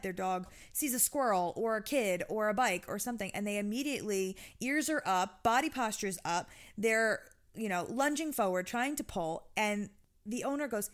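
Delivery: ordinary talk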